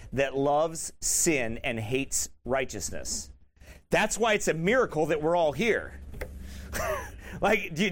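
ordinary talk, nothing marked

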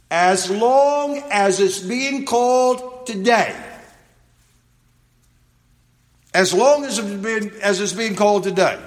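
high, drawn-out preacher's reading; emphatic scripture reading. Acted. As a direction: proclaim the verse, stretching key words. Reads scripture aloud emphatically.

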